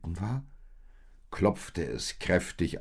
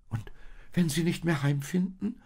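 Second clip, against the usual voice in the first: much higher, worried lamb voice; anxious little lamb; 12 semitones higher, 3 dB louder than usual. Acted. Youngest lamb anxiously asks the old sheep, much higher. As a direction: ask it fearfully like the smallest lamb. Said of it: "fragte das jüngste Lamm".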